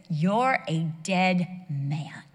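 very slow, weighted pronouncement; God's stern warning. Retold or imitated. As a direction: deliver a slow death sentence as God. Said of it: "God knows exactly what to say".

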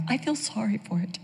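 lightly voiced mind, soft and quick; a hint of pity, hurried and hushed; sympathetic mind. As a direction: softly play the mind feeling sorry. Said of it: "But the mind's going".

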